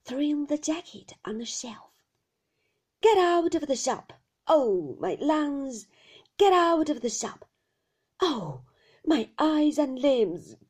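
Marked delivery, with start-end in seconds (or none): 3.0-10.6 loud, high, whining rant; furious old shopkeeper wailing; old man's cry. A narrator's reading. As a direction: yell it in a fierce falling singsong. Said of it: "cried the old man".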